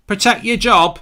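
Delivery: mock shout. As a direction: jeer it out at the manager.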